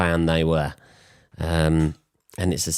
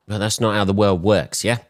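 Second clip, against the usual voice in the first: usual speaking voice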